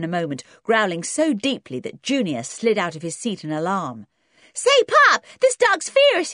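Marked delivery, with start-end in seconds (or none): 4.5-6.3 very high, loud yelp; alarmed American boy. A narrator's reading. Junior scared by the growling dog, high loud alarm. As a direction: cry out to Dad in alarm.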